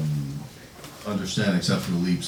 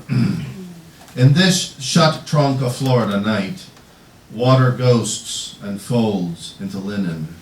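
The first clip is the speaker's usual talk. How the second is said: slow, louder poem recitation; poetry reading; 4 semitones higher, 8 dB louder, slower than usual. Acted. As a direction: project the verse in deliberate, separated phrases. Reads own poem aloud.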